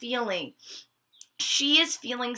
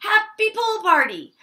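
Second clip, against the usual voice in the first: excited; 10 semitones higher than usual. Acted. Molly still excited.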